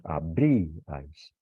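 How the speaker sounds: abríais drawn out, stress held on rí; slow model word. Shown as a demonstration. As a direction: say abríais very slowly, leaning on the rí. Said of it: "Lo que decimos". (abríais despacio, cargando la rí)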